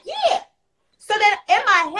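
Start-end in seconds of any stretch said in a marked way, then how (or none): 0.0-0.4 put-on little boy voice. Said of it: "he was like".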